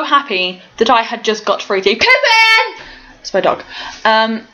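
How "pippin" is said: calling the dog. Real, not acted.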